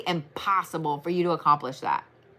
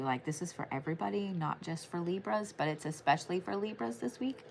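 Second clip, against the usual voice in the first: quiet aside; 13 dB quieter than usual. Real, not acted. Confiding a tip to everyone.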